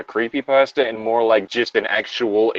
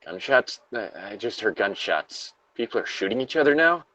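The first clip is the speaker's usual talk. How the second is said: lightly acted survivor, shocked; reading the survivor's log as the shooting starts; somber log reading, growing disbelief; 4 dB quieter than usual. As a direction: read it with rising horror at the gunshots. Reads the log, rising dismay.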